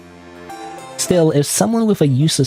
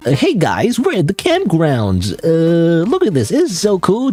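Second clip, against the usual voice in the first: higher, breezy vlogger patter; influencer voice; 7 semitones higher than usual. Retold or imitated. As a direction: hype up the product. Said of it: "that's what an influencer would sound like".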